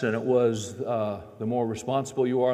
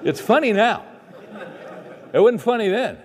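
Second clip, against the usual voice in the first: louder, higher, amused; still laughing; 9 semitones higher, 6 dB louder than usual. Real, not acted. Preacher amused, telling the congregation it's funny now. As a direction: let the laugh carry into it.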